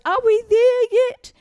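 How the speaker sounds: put-on high, slow, drawn-out child's whine; whiny little kid. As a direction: play the impatient little girl in the back seat. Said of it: "And she goes".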